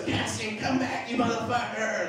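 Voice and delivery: high, loud imitated shout; hammed-up angry chase holler; Bingham shouting. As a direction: yell after someone running away down a hallway. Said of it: "this is like Brent Bingham shouting".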